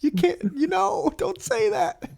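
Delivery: tiny, very high, hushed plea; embarrassed scolding. Retold or imitated. Grandson hushing old relatives in a restaurant, squeaky soft scolding. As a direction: squeak it softly so others don't hear.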